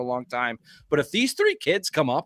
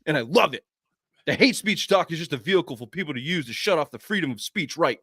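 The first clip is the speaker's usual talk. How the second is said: ordinary talk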